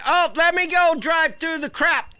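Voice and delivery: high, sneering mock of a careless kid; mocking the driver. Mocking the driver who drove through.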